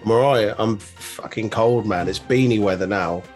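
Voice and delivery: ordinary talk, nothing marked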